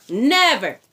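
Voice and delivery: normal talking voice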